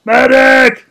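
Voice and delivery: huge, high desperate bellow of medic; shouting while wounded. Pretend. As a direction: scream for a medic like a wounded soldier. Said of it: "can you do a heavy impression crying for a medic".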